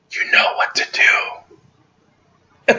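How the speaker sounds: very slow whispered hint; knowing, conspiratorial whisper. Retold or imitated. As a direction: whisper it slowly like a shared secret. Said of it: "he's like whispering to me".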